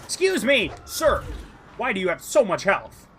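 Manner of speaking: high, loud mock-polite complaint; indignant outrage. Real, not acted. Complaining to a tough game boss, high loud indignant. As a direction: protest to the boss like a shocked customer.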